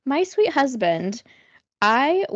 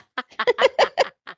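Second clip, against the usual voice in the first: high-pitched evil cackle; villainous laugh; 13 semitones higher, 3 dB louder than usual. Real, not acted. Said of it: "That sounded like an evil laugh".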